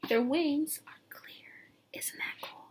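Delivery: slow hushed whisper in awe. Real, not acted. Teacher showing a clear-winged butterfly, whispering. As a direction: breathe it out in wonder. Awed remark on picture.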